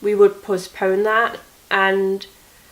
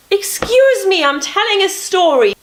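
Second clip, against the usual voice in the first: high and loud, mock-offended; indignant mock scold; 12 semitones higher, 7 dB louder than usual. Real, not acted. Telling off whoever interrupts her story, high and loud. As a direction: snap back at the interruption, pitched up.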